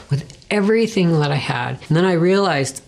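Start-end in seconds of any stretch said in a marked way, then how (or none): none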